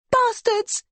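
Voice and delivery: very high, shrieking curse. A narrator's reading. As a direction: shriek it in rage. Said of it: "she shrieks".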